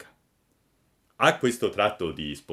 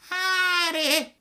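very high, slow mewling cat echo; 5 dB louder than usual. A narrator's reading. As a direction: whine it like a stray cat. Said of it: "ripeté il gatto".

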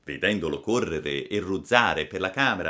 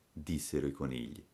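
ordinary delivery, nothing marked

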